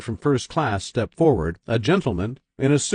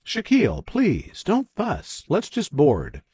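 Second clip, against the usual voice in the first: put-on higher, soothing old mother; gentle mother voice; 6 semitones higher, slower than usual. A narrator's reading. Mother calms son, voiced higher.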